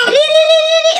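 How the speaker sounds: very high, loud "li-li-li"; imitated animal calling. Shown as a demonstration. Host imitates a call during the duck game, shrieking high. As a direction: trill it high and loud, on repeat. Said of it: "merenakan bebek".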